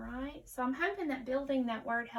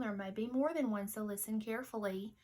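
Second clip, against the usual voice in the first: ordinary talk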